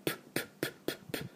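short spitting P puffs. Shown as a demonstration. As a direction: burst of air like spitting a watermelon seed. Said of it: "スイカの種を飛ばすような音". (スイカの種を飛ばすようなプッ)

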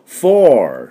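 American フォー ending in an R; American "four". Shown as a demonstration. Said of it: "アメリカ音だとフォーって R が入ります". (アメリカ音のフォー、最後にRを入れる)

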